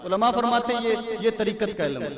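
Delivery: impassioned preaching. Real, not acted. Preacher urging listeners to feel watched by God, impassioned. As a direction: drive the point home to the congregation.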